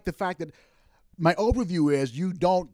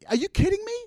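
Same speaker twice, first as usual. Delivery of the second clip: are you kidding me, thrown up high; incredulous cry at an election denier; exasperated outcry; faster than usual. Real, not acted. Indignant outburst.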